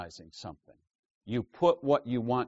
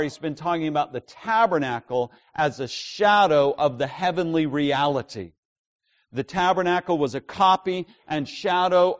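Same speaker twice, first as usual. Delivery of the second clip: louder, higher, driving sermon delivery; full-force preaching; 6 semitones higher, 8 dB louder than usual. Acted. Preacher urging the congregation about shadows, full-force preaching. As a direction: preach it out with rising intensity.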